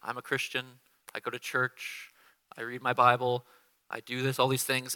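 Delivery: mock pious. Believers reciting their religious credentials, mock pious. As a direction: list your good deeds in a hushed pious tone. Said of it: "flowery language".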